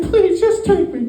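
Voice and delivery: high squeaky mock pleading; pleading falsetto. Woman begging the trafficker to take her, high falsetto plea. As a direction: beg in a hammed-up high voice. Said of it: "she's pleading with this guy".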